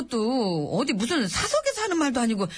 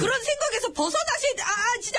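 high, flustered wailing; desperate protest; 8 semitones higher than usual. Acted. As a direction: protest helplessly while being hauled out. Aide protests while dragged out.

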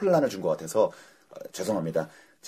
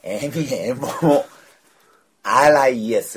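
very slow, letter by letter; spelling it out; 3 semitones higher, 5 dB louder, much slower than usual. Shown as a demonstration. Co-host answering how it is spelled, slow deliberate spelling. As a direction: draw out every letter with big gaps. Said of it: "스펠링이 어떻게 되죠".

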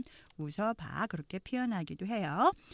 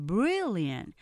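brilliant with a loud front and a weak tail; stress on BRIL; 4 dB louder than usual. Shown as a demonstration. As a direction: hit BRIL, let the end fade. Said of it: "앞에 무리가 크게 들리고 뒤에 약하게".

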